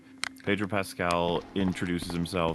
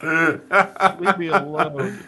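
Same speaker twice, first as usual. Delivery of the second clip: laughing plea; 9 semitones higher, 9 dB louder, much slower than usual. Real, not acted. Game character begging while laughing.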